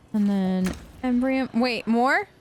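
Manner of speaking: usual speaking voice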